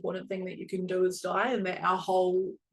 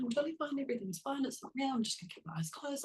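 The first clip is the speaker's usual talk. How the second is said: panicky denial; 6 semitones higher, 7 dB quieter, faster than usual. Retold or imitated. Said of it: "and she was like".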